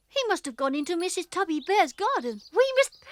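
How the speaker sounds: ordinary delivery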